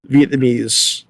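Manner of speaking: ordinary talk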